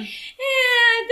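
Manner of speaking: high, noncommittal "eh"; mock resigned noise; resigned shrug. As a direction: let it go with a high little whine. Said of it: "I'm just like".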